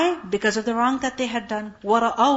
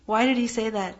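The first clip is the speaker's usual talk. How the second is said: ordinary delivery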